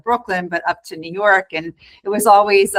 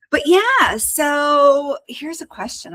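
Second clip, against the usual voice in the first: ordinary talk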